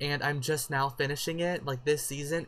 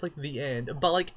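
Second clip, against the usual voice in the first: ordinary talk, nothing marked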